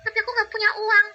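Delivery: put-on high, slow small girl's voice; little girl Cici. Child Cici speaks. (suara anak perempuan kecil)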